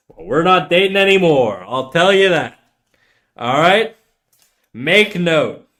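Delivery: defiant comeback. Real, not acted. Streamer announcing they're not dating anymore, defiant. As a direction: throw it back loudly and defiantly.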